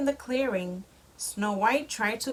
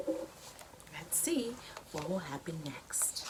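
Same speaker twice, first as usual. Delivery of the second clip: whisper; 4 semitones lower, 11 dB quieter, slower than usual. Real, not acted. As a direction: lean in and whisper the suspense. Parent whispers to child.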